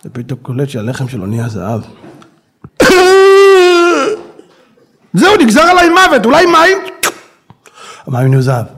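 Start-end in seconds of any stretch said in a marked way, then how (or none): 2.6-4.2 loud high choking fit; choking cough. Acted. Bread turns to gold, chokes. 5.1-6.8 much higher, loud panic; panicked despair. Acted. Man realizes he will starve.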